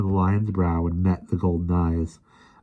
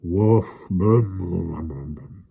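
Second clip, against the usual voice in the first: lion noises; much slower than usual. A narrator's reading. Lion answering.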